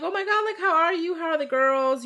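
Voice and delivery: high, bubbly fake-friendly hello; squealing sweet catch-up, all put on; mock gushing greeting. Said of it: "I would've been like".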